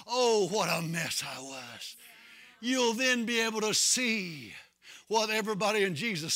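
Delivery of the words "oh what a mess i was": low, slow look back; rueful reflection. Acted. Saved believer looking back at the old self, rueful reflection. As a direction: sigh it out slowly and low. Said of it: "look back at the way you used to be and say".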